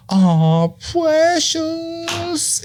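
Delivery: sudden high-pitched coo, gasping first; cooing over a baby. Retold or imitated. Hearing a baby cry in a restaurant, high gushing coo. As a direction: melt over a crying baby like it's adorable.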